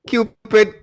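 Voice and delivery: ordinary talk, nothing marked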